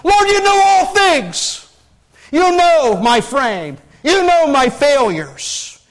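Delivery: high, loud, drawn-out cry to the Lord; anguished outcry. Retold or imitated. Peter collapsing before Jesus, anguished outcry. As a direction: cry it out slowly at full voice, breaking down. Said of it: "Peter just collapsed in this".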